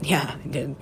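ordinary talk, nothing marked

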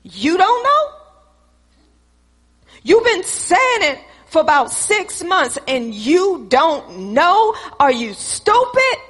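acted-out scolding with incredulous rises; changed, exasperated tone. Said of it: "Why did your tone change".

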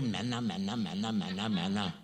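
low, soft droning repeat of manna; mock sing-song whine. Israelites sick of manna, droning in low soft mockery. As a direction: drone the word manna, bored and fed up.

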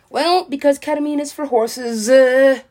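high, booming punchline delivery; exaggerated, high-pitched exclamation; loud mock outburst. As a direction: blurt the answer out loudly, ending on a big "uh". Answers own question in loud outburst.

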